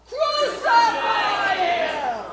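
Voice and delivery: very loud, high, stretched-out yell; long screamed chant; mob roar. A narrator's reading. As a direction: bellow it slowly with the whole mob. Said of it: "they were the mindless mob".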